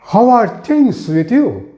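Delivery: high, slow "things with you"; musical greeting. Shown as a demonstration. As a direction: sing out "things" high, then let "you" fall. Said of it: "just add up some music with it".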